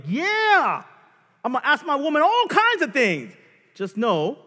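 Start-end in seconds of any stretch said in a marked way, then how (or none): 0.0-3.3 loud, high cocky boast; mock macho husband. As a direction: crow it like a proud husband. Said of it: "some of you are like".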